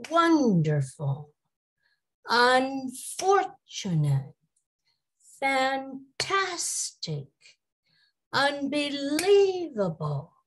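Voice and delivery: each word said slowly, the stressed syllable punched; word stress demo. Shown as a demonstration. Teacher modelling word stress with hand claps. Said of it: "Clap your hands to emphasize the stressed syllable".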